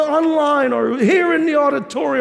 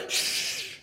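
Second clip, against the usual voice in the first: shushing; 13 dB quieter than usual. Shown as a demonstration. Shushing kids while Jesus preaches.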